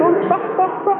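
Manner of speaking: very high-pitched mock panic; high, urgent retold pleading; alarmed friend. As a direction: act out a frantic friend begging him to stop. Friend begs him to stop pounding.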